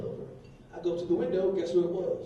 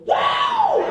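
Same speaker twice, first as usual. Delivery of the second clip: loud high mock squeal; 10 dB louder than usual. Lady at the bar cheers the DJ, loud high mock squeal.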